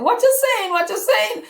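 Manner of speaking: can't believe what he's hearing; high, loud amazed repeat; astonished. Retold or imitated. Son surprised, laughing at news.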